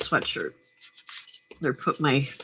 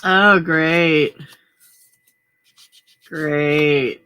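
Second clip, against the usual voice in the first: dry sarcastic oh great; 7 dB louder, much slower than usual. Real, not acted. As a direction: sneer it, sarcastic. Sarcastic reaction to bad news.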